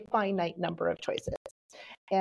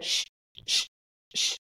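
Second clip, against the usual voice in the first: imitated halting "shh" sounds, spaced apart; false starts on the first sound of a name; stuck on a name. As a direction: mimic someone failing to get past "Sh".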